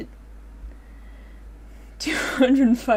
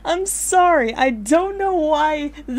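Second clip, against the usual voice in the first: laughing apology; 7 semitones higher, 6 dB louder, slower than usual. Real, not acted.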